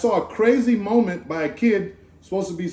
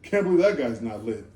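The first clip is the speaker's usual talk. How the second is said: dismissive scoff; 9 semitones lower, 4 dB quieter than usual. Retold or imitated. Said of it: "and you say".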